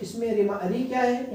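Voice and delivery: ordinary talk, nothing marked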